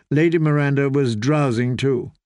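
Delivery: ordinary delivery, nothing marked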